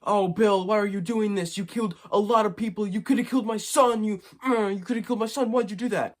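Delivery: overacted hurt twin begging his brother; the good twin whining at his killer brother; pleading Hal. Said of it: "like, saying".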